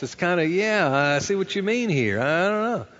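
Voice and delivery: ordinary delivery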